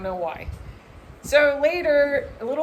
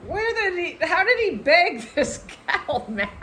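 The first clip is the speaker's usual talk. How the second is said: amazed laughing question; 8 semitones higher than usual. Retold or imitated. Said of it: "I was like".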